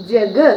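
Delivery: ജഗ് said jag, loud, ending on bare g; right reading. Shown as a demonstration. Teacher announces right reading ജഗ്, loud, bare g at end. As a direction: punch ജഗ് out, no vowel after g. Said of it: "എന്തായി".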